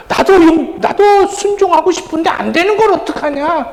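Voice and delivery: high-pitched, imitated spouse's whine of helplessness; mock exasperated protest. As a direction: act out a spouse protesting back. Spouse protesting when told off, retold.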